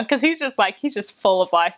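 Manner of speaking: ordinary delivery, nothing marked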